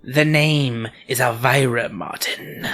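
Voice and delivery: slow, sharp ghostly correction; haughty, put-on ghost voice. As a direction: correct them sharply, drawing it out. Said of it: "the voice says sharply".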